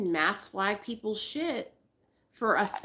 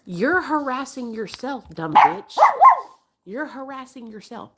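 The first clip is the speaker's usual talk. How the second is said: exasperated rant; 6 semitones higher, 8 dB louder than usual. Real, not acted. Ranting at influencer.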